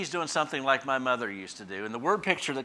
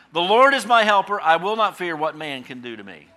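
bold proclamation; 3 semitones higher, 8 dB louder than usual. Acted. Quoting psalm, preaching.

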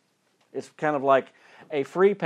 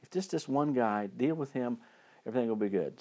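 confidential aside; 8 dB quieter, faster than usual. Acted. Haman asking the king a little favor, mock whisper. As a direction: drop the volume, slip in a casual favor. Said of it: "I just have to whisper in his ear".

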